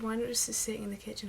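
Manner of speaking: usual speaking voice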